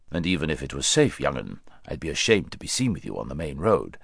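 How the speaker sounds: lightly voiced proud old horse. A narrator's reading. Bree teases Shasta.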